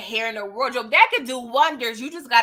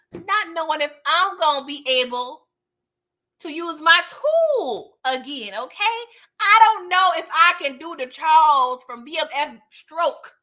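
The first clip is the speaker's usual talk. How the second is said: acted aggrieved rant; 4 semitones higher, 4 dB louder than usual. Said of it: "He was like".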